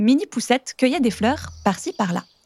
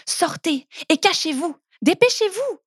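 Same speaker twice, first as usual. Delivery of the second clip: urgent; 6 semitones higher than usual. Acted. Urging friends to hide quickly.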